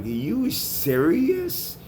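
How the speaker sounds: high, slow, drawn-out disbelief; incredulous. Retold or imitated. The guy at the counter, stunned by her demand, slow and high. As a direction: stretch it out in mock disbelief. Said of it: "he's like".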